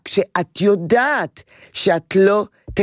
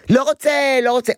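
put-on high, childish refusal; whiny refusing child; 8 semitones higher than usual. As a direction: play the kid who won't say goodbye. Child refusing goodbye hug. (ילד מסרב בקול גבוה ומתבכיין)

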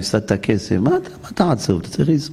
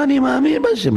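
pitched well up, mocking claim; mock believer; 10 semitones higher than usual. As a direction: imitate a smug, empty believer. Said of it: "הוא אומר".

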